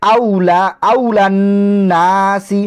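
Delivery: আউলান নাসি chanted, n held long; ghunnah recitation. Shown as a demonstration. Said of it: "এখানেও একটা গুণনা হয়েছে".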